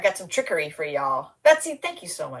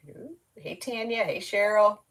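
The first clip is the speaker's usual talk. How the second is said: normal talking voice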